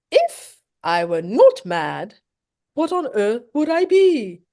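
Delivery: a giddy, unhinged Hatter savouring each line, with a laugh; Mad Hatter voice. Acted. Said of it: "written in a Mad Hatter type voice".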